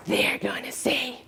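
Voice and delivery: hushed, slow whisper; whispered inner critic. Retold or imitated. Woman hearing her inner critic start again, whispering. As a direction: breathe it like the nagging voice in your head.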